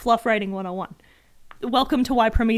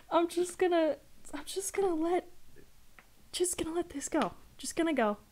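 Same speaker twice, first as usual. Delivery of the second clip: soft, higher, near-whispered resignation; stifled, giving up; 7 semitones higher, 6 dB quieter than usual. Real, not acted. Streamer deciding to let the absurd line go, softly stifled. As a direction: barely get it out, half whispered.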